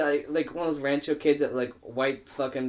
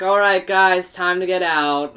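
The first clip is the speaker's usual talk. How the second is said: loud, raised-pitch announcement to the room; calling out; 6 semitones higher, 10 dB louder, slower than usual. Retold or imitated. Host clearing guests out of a party, calling out. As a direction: call out firmly to everyone.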